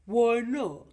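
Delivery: put-on loud father echoing the child's question; Gruffalo father. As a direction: throw the question back loudly, pitch falling. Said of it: "said the Gruffalo".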